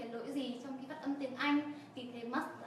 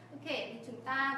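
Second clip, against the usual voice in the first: normal talking voice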